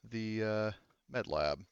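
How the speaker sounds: ordinary talk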